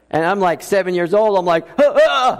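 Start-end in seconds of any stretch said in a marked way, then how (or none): 1.8-2.4 retold childhood scream, high and loud; mock scream. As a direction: cry out like a scared boy. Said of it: "and I scream out loud".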